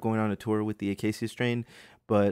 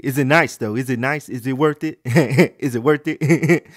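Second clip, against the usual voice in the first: loud, pestering mock customer; loud obnoxious customer; 3 semitones higher, 10 dB louder than usual. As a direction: badger noisily as an oblivious shopper. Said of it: "be that loud and obnoxious".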